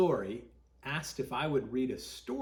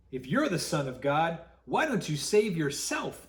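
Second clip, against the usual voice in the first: higher, louder jeering from the crowd; mocking shout; 4 semitones higher, 7 dB louder than usual. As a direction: taunt him like a mocking mob. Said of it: "they shouted".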